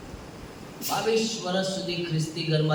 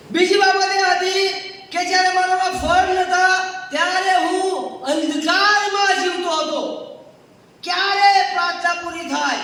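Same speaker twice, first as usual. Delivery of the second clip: loud, driving pulpit delivery; preaching at full force; 13 semitones higher, 5 dB louder than usual. Acted. Preacher to congregation, forceful preaching. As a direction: hammer each line home to the church.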